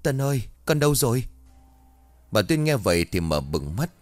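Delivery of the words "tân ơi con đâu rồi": frantic high call, faster; yelling for a missing child; shout. A narrator's reading. Mother calling her lost son, frantic shout. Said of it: "bị cắt ngang bởi một tiếng hét".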